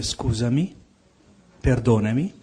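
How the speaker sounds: ordinary talk, nothing marked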